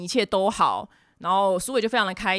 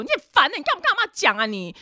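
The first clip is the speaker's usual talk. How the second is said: angry scolding; 8 semitones higher than usual. Retold or imitated. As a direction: snap at her for telling grandma. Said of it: "他真的就是破口大骂说".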